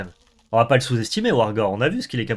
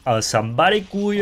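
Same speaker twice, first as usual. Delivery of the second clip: punchy catchphrase delivery; quoting a catchphrase; 5 semitones higher, 4 dB louder than usual. Retold or imitated. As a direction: say it like a famous quote. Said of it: "Comme dirait le regretté".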